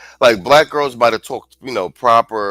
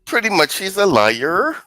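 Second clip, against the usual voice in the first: higher, every phrase rising like a question; mocking Valley Girl uptalk; 8 semitones higher than usual. Streamer mocks woman's bodycam answer in mild Valley Girl uptalk. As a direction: lightly mimic her question-like lilt. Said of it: "ending their sentences like questions".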